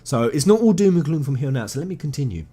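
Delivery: ordinary delivery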